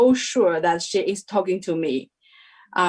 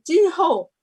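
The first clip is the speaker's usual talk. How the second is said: high, louder call of a name, mock summons; 10 semitones higher, 4 dB louder than usual. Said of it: "She said".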